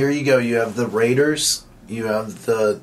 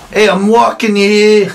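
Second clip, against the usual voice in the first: put-on loud, much higher Brooklyn sports fan yelling in a Brooklyn accent; 9 semitones higher, 9 dB louder than usual. Cohost riffing for the host, loud Brooklyn accent shout. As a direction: bark it like a Brooklyn cabbie in a thick Brooklyn accent. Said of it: "That's a real sports fan from Brooklyn".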